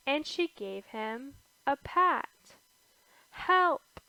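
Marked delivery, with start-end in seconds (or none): 3.3-4.0 high, louder call for help; crying for help. A narrator's reading. Scared boy calls to his mother, high urgent cry.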